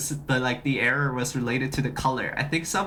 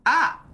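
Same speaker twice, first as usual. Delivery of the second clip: sudden high, louder "ah"; startled yelp; 11 semitones higher, 5 dB louder than usual. Real, not acted. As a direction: yelp in alarm at the glitch. Startled by gizmo malfunction.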